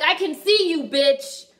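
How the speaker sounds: high, loud sassy cat voice; a hammed-up cat calling out the ghost; voicing the cat. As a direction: play the cat taunting the ghost. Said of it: "the cat is like".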